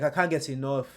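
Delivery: usual speaking voice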